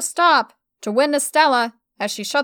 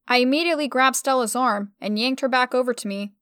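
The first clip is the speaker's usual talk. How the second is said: plain reading voice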